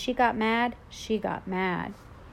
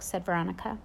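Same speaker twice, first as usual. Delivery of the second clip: ordinary delivery, nothing marked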